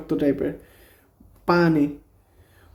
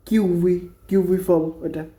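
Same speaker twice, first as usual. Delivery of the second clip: ordinary talk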